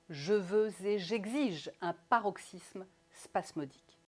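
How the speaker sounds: theatrical, separated syllables in "j'exige"; over-articulate "paroxysme spasmodique" crisply; articulation drill. Shown as a demonstration. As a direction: hammer out the consonants of "paroxysme spasmodique". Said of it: "Autre phrase très efficace".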